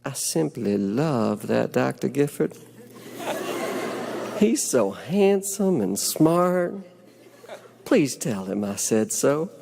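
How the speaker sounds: slow, lightly put-on Southern accent, drawing out the praise; a mild imitation of a Southern belle's accent; affected Southern accent. Homeless patient gushing about her doctor, in a slow affected Southern accent. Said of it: "in her affected Southern accent".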